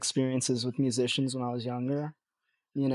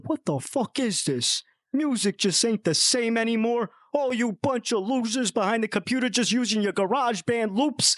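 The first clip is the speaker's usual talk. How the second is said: high, hammed-up angry rant; mock a grumpy rocker yelling; cartoonish old-rocker tirade; imitating angry musician; 9 semitones higher than usual. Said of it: "He said".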